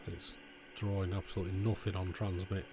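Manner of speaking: ordinary delivery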